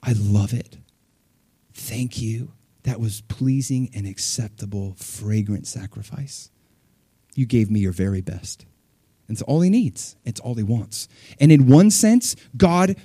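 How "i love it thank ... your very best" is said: quiet, tender approval; God's tender voice. Retold or imitated. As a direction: lower and softer, slow and gentle. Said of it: "the Lord's like".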